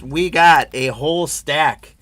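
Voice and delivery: ordinary talk, nothing marked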